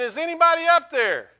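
high, loud cry for help from a cliff; desperate yell. Acted. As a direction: shout it up into the empty sky. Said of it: "he keeps screaming".

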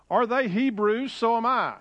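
high-pitched boastful scripture reading; bragging. Acted. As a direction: read Paul's words as a proud boast. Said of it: "A little, little bragging here".

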